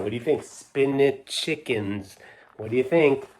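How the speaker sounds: plain reading voice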